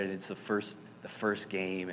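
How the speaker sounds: usual speaking voice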